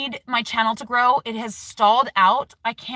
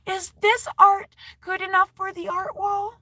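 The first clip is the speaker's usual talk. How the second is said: lightly voiced eager child, pitched higher; little girl's voice; 9 semitones higher than usual. Mom retelling her daughter asking about the art wall, higher child voice. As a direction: ask it like a hopeful little kid. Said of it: "Ruby came up to me".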